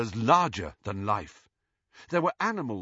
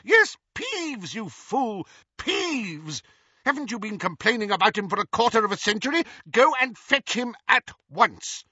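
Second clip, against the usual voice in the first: impatient scolding; 10 semitones higher than usual. Acted. Scolding the caretaker.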